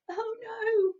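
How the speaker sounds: high, loud dismay; surprised oh no. A narrator's reading. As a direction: cry out in dismay. Storyteller startled.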